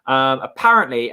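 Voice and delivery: ordinary delivery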